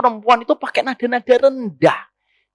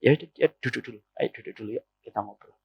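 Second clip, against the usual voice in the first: a hushed, settled husband's reply; husband answers his wife in a soft low voice; calm husband; 11 semitones lower, 10 dB quieter, faster than usual. Acted. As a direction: lower and softer, gently inviting to sit. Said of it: "Biasanya apa suami?".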